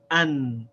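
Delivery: "an" with a stopped, vowelless n; demo syllable an. Shown as a demonstration. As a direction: say "an" and stop on the n. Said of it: "We say".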